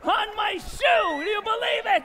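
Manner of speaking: high, loud, ecstatic exclaiming; hammed-up amazement. Magician finds the lace on his shoe, wildly amazed. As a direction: crow it, stretching the key words.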